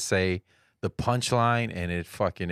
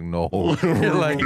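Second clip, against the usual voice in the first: creepy laugh voice; 4 dB louder, slower than usual. Retold or imitated. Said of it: "the laugh sounds the worst in that voice".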